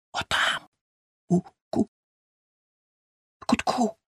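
breath-only, halting answer; stammering whisper. A narrator's reading. Kid tells his twin where the sound is, stammering whisper. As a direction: whisper it, tripping on the last word. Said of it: "затинаючись".

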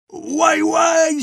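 very high, loud yowl; panicked wail. A narrator's reading. Baker gets tar poured on his head, panicked wail. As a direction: wail out in shock. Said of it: "закричав Бомштекс".

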